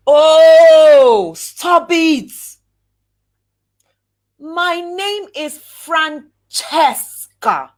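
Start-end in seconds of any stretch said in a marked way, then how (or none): 4.4-7.7 slow proud introduction. Shown as a demonstration. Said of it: "demo introduction".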